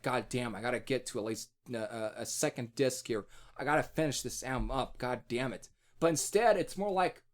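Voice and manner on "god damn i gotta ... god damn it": weary inner grumble. Retold or imitated. Reviewer grumbling about getting through the album, low muttered. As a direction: lower and softer, muttering to himself. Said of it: "It's like".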